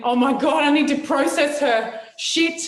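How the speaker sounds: imitating someone starting to freak out; mock panic. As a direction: act out an alarmed inner monologue, rising to the last word. Acts out listener's panicked inner reaction.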